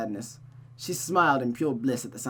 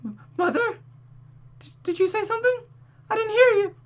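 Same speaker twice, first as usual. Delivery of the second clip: high, fast, anxious stammering; frightened girl listening for her mother; worried stammer; 5 dB louder, faster than usual. Acted. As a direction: stutter out fearful questions. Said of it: "her face contorted into worry".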